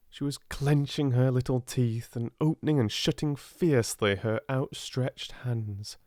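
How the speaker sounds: ordinary delivery, nothing marked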